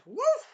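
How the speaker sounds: high, loud whoosh of a blow; punch sound effect. Retold or imitated. Philo punching him to Kingdom Come, whooshed impact noise. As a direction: imitate a punch sending someone flying. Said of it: "She punched them like this".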